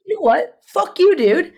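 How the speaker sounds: high, loud indignant comeback; retold snapping. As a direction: re-enact losing your temper at a stranger. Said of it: "I'm just snapping at this point".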